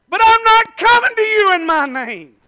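high, loud, slow declaration; preaching cry. Real, not acted. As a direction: proclaim it to the rafters. Preaching climax.

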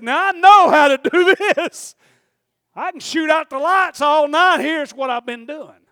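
laugh, then a high, booming boast; exuberant shout. Retold or imitated. Preacher retelling his answer to being asked to preach, exuberant shout. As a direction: burst out loud and high, stretching the words.

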